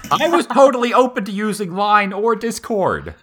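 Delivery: high, loud mock-indignant protest; defensive protest. Real, not acted. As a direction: insist you were always willing.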